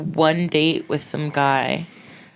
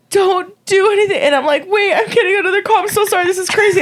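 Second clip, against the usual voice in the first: very high, frantic pleading; panicked; 3 dB louder, faster than usual. Retold or imitated. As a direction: rush it out in a panic. Retold panicked stalling.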